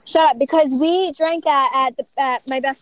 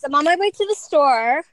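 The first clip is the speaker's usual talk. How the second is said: normal talking voice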